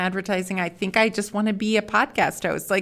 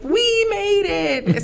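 quoted cry of triumph, pitched high and louder; excited; 12 semitones higher, 3 dB louder, slower than usual. Retold or imitated. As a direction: burst out like you just made it big. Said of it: "I was like".

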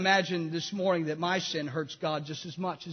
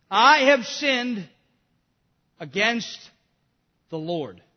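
slow solemn confession; 4 dB louder, slower than usual. Acted.

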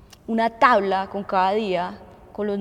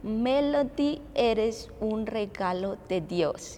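hija diciendo un cumplido; 3 dB quieter, much slower than usual. Retold or imitated. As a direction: imitate a daughter slowly reciting a compliment. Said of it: "Ariana un día llega y dice". (niña hablando despacio, imitada)

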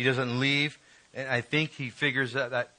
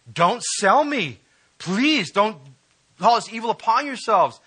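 pleading; 9 semitones higher, 6 dB louder than usual. Retold or imitated. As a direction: cry out to the brothers for mercy. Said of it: "Joseph is there pleading with them".

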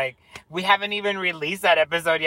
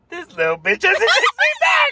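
mock outrage; 4 dB louder than usual. Retelling own angry text reaction.

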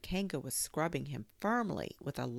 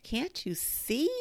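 high, drawn-out exasperated cry; small creature crying out in protest; shouted; 10 semitones higher, 4 dB louder, slower than usual. A narrator's reading. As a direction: call it out high and slow. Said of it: "shouted Piglet".

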